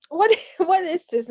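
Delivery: high, laughing disbelief, retelling the moment; baffled laughing question. Retold or imitated. To the embassy guest, laughing high surprise. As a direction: ask it amused, hearing this name for the first time. Baffled at unknown foundation name.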